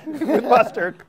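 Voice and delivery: laughing through words, slowly. Real, not acted. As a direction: giggle the words out. Both laughing at the mustard.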